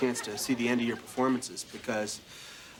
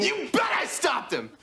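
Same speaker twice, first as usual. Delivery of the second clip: proud triumphant shout; 14 semitones higher, slower than usual. Real, not acted. Proud injured player boasting.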